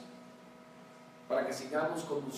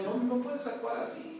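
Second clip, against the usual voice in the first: gentle voice of God; 8 semitones higher than usual. Retold or imitated. God reminding the pastor to be an example, gently higher. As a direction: patiently correct him. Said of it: "Dios me dice".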